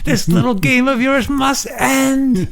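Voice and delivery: high mock voice. Mocking a movie character, high put-on voice. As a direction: declare it in a silly high voice.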